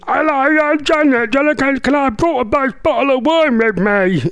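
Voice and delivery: high, hammed-up caricature voice; Janet Street Porter. Host imagining her arriving for a night in, high caricature. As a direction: push the impression way up high, exaggerated. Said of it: "Imagine her come round here".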